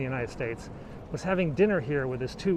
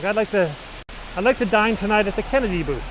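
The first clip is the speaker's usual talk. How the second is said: fancy diner booking; 5 semitones higher, 7 dB louder than usual. Retold or imitated. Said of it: "it's like".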